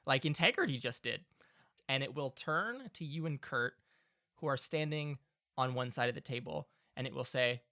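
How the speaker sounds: normal talking voice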